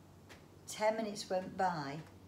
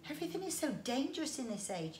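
moaning; 7 semitones higher, faster than usual. Acted. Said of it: "he moaned".

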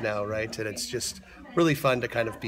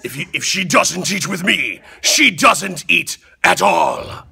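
loud, higher, roaring beast voice; gruff Beast voice; 6 semitones higher, 11 dB louder, slower than usual. Acted. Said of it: "Can you give us a line in that gruff voice?".

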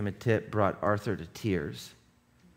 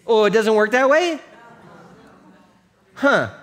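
mock surprise; 9 semitones higher, 8 dB louder than usual. Mock disappointment after the shouting.

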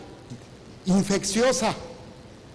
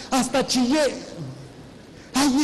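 feligresa conmovida; 5 semitones higher, slower than usual. Retold or imitated.

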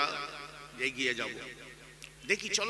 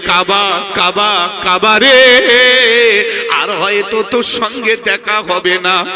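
tearful farewell lament; 3 semitones higher, 16 dB louder than usual. Acted. The Prophet bids the Kaaba goodbye before leaving for Medina, loud tearful lament. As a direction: wail it out as a preacher's sung lament. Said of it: "নবী কাঁদে আর বলে".

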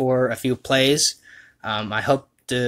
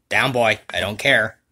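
usual speaking voice

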